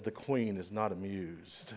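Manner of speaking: low, soft mock-English accent; lightly put-on regal British accent; imitated British accent. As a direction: quote the English wife quietly, with a British accent. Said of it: "if you talk in an accent".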